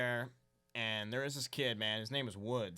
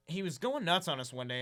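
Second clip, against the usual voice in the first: ordinary talk, nothing marked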